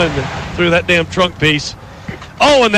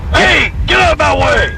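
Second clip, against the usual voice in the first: mock road rage yell; 8 semitones higher than usual. Pretending to yell at drivers.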